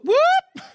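very high, loud rising siren whoop; imitated police siren whoop. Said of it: "I hear this whoop".

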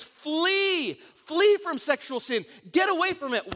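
urgent repeated flee; emphatic warning. Retold or imitated. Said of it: "another translation says".

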